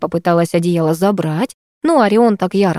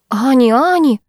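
high, louder panicked calling; panicky; 6 semitones higher, 4 dB louder than usual. Acted. Майя panics when her friend starts sobbing. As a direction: call her name in a panic. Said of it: "почему-то запаниковала я".

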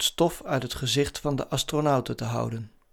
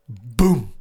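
luide, uitgeroepen 'boem'; botsknal; 3 semitones lower, 6 dB louder than usual. A narrator's reading. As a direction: laat de botsing knallen. Sound of the crash. (harde klap nabootsen)

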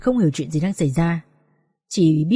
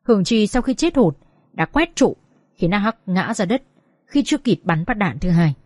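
usual speaking voice

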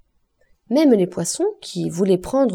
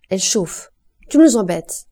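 sharp, louder fed-up retort; irritated snap; 5 dB louder than usual. Acted. Wife snapping back at her drunk husband, exasperated. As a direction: cut him off, she's had enough.

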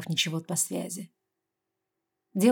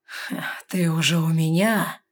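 slow, louder, smiling tease; seductive smile; 4 dB louder, slower than usual. A narrator's reading. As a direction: purr it with a flirty smile. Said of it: "сексуально улыбаясь".

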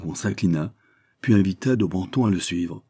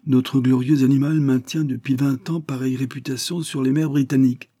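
higher, protesting officer voice; indignant English officer; 7 semitones higher, 3 dB louder than usual. Acted. Officer protesting to the French captain, indignant. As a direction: defend the commodore's honour, raised.

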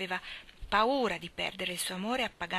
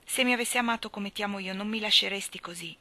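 ordinary delivery

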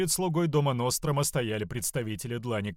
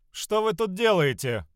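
high, loud challenge from a haughty overseer; pompous indignant outburst; heated arrogance; 8 semitones higher, 4 dB louder than usual. Acted. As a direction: demand answers, pitched high and indignant. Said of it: "Утратив оттенок жаркой спеси".

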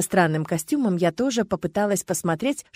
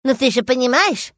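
higher, louder mocking echo; mocking mimicry; 5 semitones higher, 5 dB louder than usual. A narrator's reading. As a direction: mimic him to tease. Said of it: "передразнила его".

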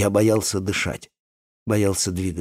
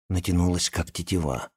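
ordinary delivery

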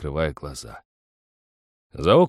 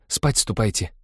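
mildly stern dismissal; a touch of sternness; stern order; 3 semitones higher than usual. A narrator's reading. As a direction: send them off strictly. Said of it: "строго сказала Люба".

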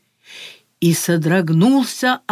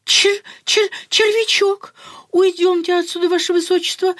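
much higher, stammering fright; terrified stammer; 9 semitones higher than usual. Acted. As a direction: squeak and stutter in panic. Marquis stammers at the worm.

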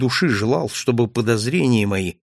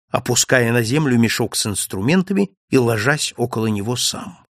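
ordinary delivery

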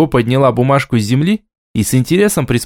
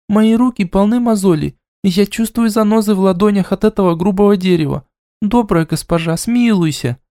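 mock pleading; 7 semitones higher than usual. A narrator's reading. Deyu begs in mock suffering.

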